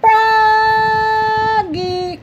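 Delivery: long, high, loud sung call. Acted. Mother calls Froggy from inside, long sung call. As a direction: call him from far off, singing his name. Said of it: "called his mother".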